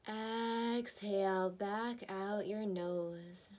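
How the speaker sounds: slow, drawn-out meditation voice with long pauses; guided meditation. Acted. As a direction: guide them breath by breath, stretching the words slowly. Leads a breathing meditation.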